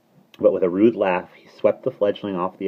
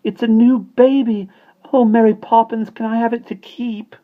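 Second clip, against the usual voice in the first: put-on high, loud excited child; thrilled, piping voice; 9 dB louder than usual. A narrator's reading. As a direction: gush and plead like a little girl. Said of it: "Jane cried excitedly".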